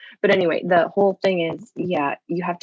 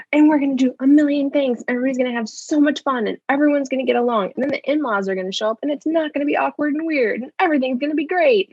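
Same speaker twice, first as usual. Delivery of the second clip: over-eager planner; 7 semitones higher, faster than usual. Retold or imitated. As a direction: rattle off the perfect plan, giddy. Over-planning parent imitated.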